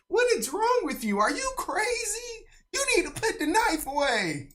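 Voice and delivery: shrill-pitched mimic of a frantic woman; high mocking woman voice. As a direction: imitate her, high and pleading.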